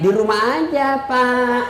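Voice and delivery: lightly voiced coy employee; soft sultry voice. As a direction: drawl it sweetly to the boss. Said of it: "jangan kasih sahdu suaramu". (pegawai muda menjawab kepala dinas dengan suara syahdu)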